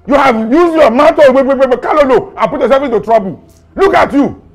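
angry berating. Acted. Officer blaming suspect for his trouble, angry berating. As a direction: lay into the suspect forcefully.

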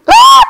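short, very high shriek; piercing high scream. Real, not acted. Player caught by a game trap, shrieking. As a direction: shriek in shock.

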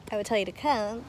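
usual speaking voice

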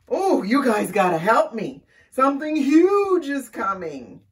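loud, urgent storybook cry for help; panicked plea. A narrator's reading. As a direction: plead loudly as danger closes in. Scared animal pleads for help.

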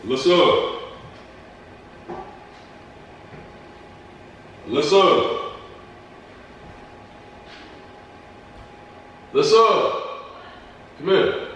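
high sing-song call across the house. Real, not acted. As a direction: call her from the next room. Calling girlfriend from another room.

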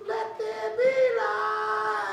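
high, slow sung little voice; tiny high voice calling from the back. Acted. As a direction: sing it out high like a small creature.

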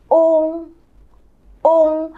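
Ung with the lips left in a small ring; open-lipped Ung; teacher repeats model; Chinese ung. Shown as a demonstration. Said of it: "môi ... không chụm kín lại".

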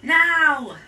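commanding shout. A narrator's reading. Rebel signalling phase two to the kids, shouting. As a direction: launch the attack with one shout. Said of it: "the rebel gave the order".

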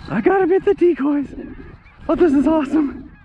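can't contain the thrill, Oh this is awesome. Real, not acted. Hunter watching birds land in his decoys, thrilled.

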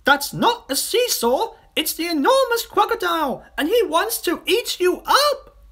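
very high, loud monkey voice; Mugglewump shouting. A narrator's reading. Monkey warning children of a disguised crocodile, high-pitched shouting. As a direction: shout an urgent alarm. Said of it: "Mugglewump shouted to the children".

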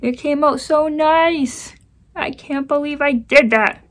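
high, loud, slow anguished lament; real frustration, pitched high and loud; frustrated wail. Real, not acted. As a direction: wail at your own mistake. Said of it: "Oh, I'm so mad".

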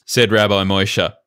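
normal talking voice

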